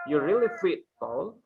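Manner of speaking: "really" stressed and falling, "Paul" rising; teacher modelling, stress on "really"; model sentence stress. Shown as a demonstration. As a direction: say it for the class to repeat. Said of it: "Just repeat after me".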